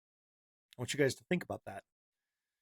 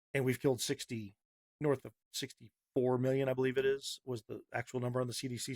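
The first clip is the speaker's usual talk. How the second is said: ordinary delivery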